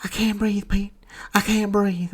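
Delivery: high-pitched mock dying aunt; hammed-up feeble high voice; Aunt May. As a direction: gasp it out in a strained falsetto. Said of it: "And then she just goes".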